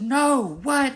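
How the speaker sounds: high, loud shocked cry; shocked yell. Retold or imitated. Otter bites the eel.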